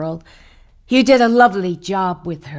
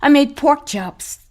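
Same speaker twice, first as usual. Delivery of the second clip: high, slow and insistent; urgent coaxing; 6 semitones higher, 4 dB louder than usual. Acted. Wife urging her husband to come home, high and insistent. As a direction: coax him up off the floor.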